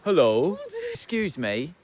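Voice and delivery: put-on a first try at a Canadian accent that comes out British; half-hearted stab at a Canadian accent, polite; clumsy Canadian accent attempt drifting into British. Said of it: "No, that's the wrong accent".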